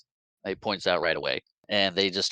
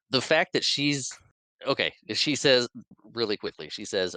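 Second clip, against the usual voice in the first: ordinary talk, nothing marked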